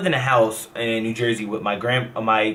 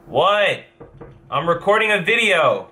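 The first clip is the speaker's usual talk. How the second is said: startled; 7 semitones higher, 3 dB louder, slower than usual. Real, not acted. Reacting to interruption while recording.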